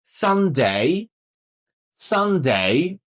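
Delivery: সানডে with a long gliding "ay" in ডে; posh pronunciation. Shown as a demonstration. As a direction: draw out "san-dey", tune dipping then rising. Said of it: "ফস উচ্চারণটা আমরা শিখে নিলে".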